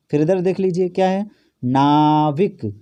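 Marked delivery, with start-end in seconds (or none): 1.6-2.7 "नाविक" drawn out very slowly; slowly sounded out. Shown as a demonstration. Teacher slowly pronounces "नाविक" for pupils. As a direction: stretch "नाविक" syllable by syllable. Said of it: "उच्चारित करिए".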